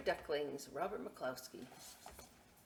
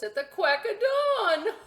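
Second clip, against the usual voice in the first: high, loud laughing punchline; 14 semitones higher, 12 dB louder than usual. Acted. Host laughing at own duck joke. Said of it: "I'm gonna laugh right out loud".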